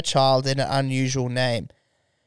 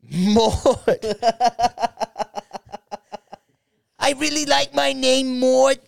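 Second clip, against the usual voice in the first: put-on high, prim old lady voice; 8 semitones higher than usual. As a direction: introduce yourself as a proper old lady. Playing an old lady named Maud.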